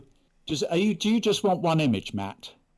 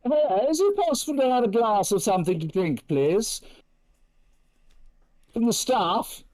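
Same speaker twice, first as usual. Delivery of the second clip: posh guest ordering; 7 semitones higher than usual. Pretend. Pretends to ring for a drink.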